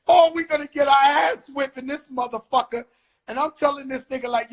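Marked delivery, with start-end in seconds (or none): 0.1-2.9 high, loud, half-laughing panic; panicked outburst. Retold or imitated. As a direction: blurt it out in alarm. Panicked thought at the precinct.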